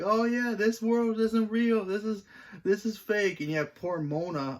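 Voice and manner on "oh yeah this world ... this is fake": high, loud, slow mock proclamation; mock know-it-all Gary. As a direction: play up Gary's smug discovery, much higher and louder. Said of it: "Gary being one of those guys who's like".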